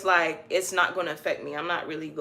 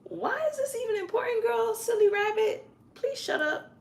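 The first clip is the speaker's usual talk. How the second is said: high, sassy mock retort with a laugh; sassy snark. Imagining mouthing off to her teacher in a high sassy voice. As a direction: brush off the teacher with a giggly diva voice. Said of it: "I'm like".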